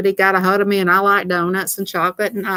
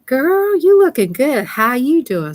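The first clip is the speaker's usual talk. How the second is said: much higher, louder gushing compliment; excited greeting; 7 semitones higher, 4 dB louder than usual. Shown as a demonstration. Telling viewers how to greet her, excited and gushing. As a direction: squeal it like you just spotted your best friend. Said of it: "go over there and say".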